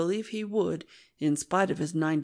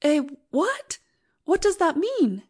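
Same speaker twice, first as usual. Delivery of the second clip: startled; 8 semitones higher, 3 dB louder than usual. Acted. Startled wife hears ultimatum news.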